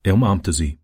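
usual speaking voice

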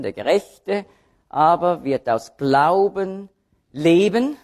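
"Gerechte", "Glauben" and "leben" each set apart and accented; slowly weight "Gerechte", "Glauben", then a rising "leben"; three separate accents. Shown as a demonstration. Preacher showing three key terms, accents on "Gerechte", "Glauben", "leben". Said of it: "die Akzente liegen auf den drei Begriffen".

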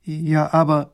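lightly voiced princess, taken aback; young princess. A narrator's reading. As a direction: object hesitantly as the princess. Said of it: "sagt die Prinzessin".

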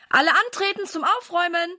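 höher gerufen, leicht lauter; rufen. A narrator's reading. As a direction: durchs Haus rufen, wie ein Kommando. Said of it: "ruft er durch das ganze Haus". (Papa ruft die Kinder zum Aufräumen, höher gerufen)

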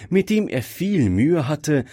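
ordinary delivery, nothing marked

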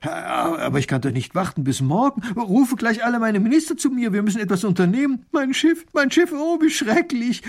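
a small ruler wailing for his new ship; distraught little grand duke. Acted. Duke frets, orders ministers.

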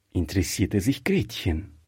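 usual speaking voice

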